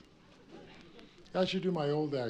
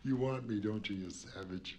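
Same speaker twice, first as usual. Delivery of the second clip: sultry tease; 5 semitones lower, 6 dB quieter than usual. Acted. Mock seducing an audience member.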